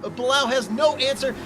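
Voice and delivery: high, urgent play-by-play; excited race call. Real, not acted. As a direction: ride the tension of the battle. Three-car battle live.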